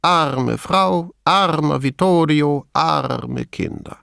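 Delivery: ARME drawn out in each phrase, slow beat; measured mocking refrain. A narrator's reading. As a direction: recite Arme Frau, armer Vittorio, arme Kinder like a singsong litany. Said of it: "Er schlug mit dem Löffel den Takt". („arme“ gedehnt, spöttisch im Takt geleiert)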